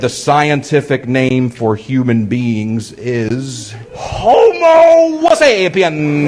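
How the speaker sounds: hammed-up Italian accent on Homo sapien; mock Italian accent. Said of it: "I said".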